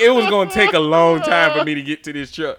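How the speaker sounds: usual speaking voice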